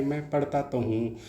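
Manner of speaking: just say it flat and soft; teacher contrasts the ordinary version; plain unstressed. Shown as a demonstration. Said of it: "ऐसे ही साधारण नहीं".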